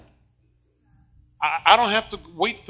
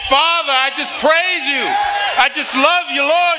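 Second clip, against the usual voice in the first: high, loud praise to God; shouted praise; 9 semitones higher, 4 dB louder than usual. Shown as a demonstration. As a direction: shout it out, full of joy. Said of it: "That's the kind of praise".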